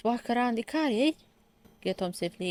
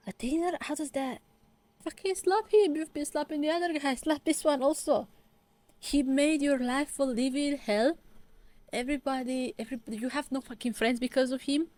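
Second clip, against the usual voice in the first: exasperated rant; 6 semitones higher than usual. Real, not acted. Vlogger yelling at the drama heroine, exasperated. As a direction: yell at her to fight back.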